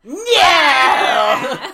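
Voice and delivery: loud, drawn-out shout; hammed-up outcry. Imitating Miss Shields, the dramatic teacher, hammed-up outcry. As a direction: shout one long theatrical cry.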